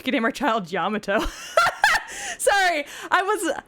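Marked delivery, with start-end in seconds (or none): 1.2-2.3 sudden, very high laugh; uncontrolled squealing giggle; laughing burst. Real, not acted. Cracks up at seeing Yamato. 2.4-3.5 high, laughing voice; real leftover laughter, pitched high; apologizing through laughter. Real, not acted. Apologizing while still laughing.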